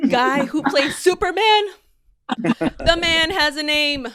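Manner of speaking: incredulous echo, then slow emphatic correction; mock indignation. Comedian correcting fans who forgot Henry's name, mock indignant. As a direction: act affronted on his behalf.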